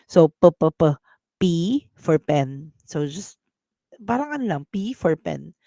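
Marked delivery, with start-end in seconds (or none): 0.3-0.9 p, p, p with strong puffs of air; aspirated p. Shown as a demonstration. Teacher demonstrates aspirated p, puffed and loud. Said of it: "this is an aspirated P". 1.4-2.7 P for pen, slow, breathy puff on P; teacher models P for pen slowly, aspirated P. Shown as a demonstration. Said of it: "this is an aspirated P".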